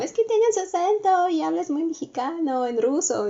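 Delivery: mom's remark. Retold or imitated. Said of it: "mi mamá me dice como".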